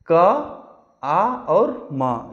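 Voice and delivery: आ और म drawn out one by one; slow spelling. Shown as a demonstration. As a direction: spell each sound slowly for the class. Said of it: "spelling करके पढ़ेंगे". (धीमे हिज्जे)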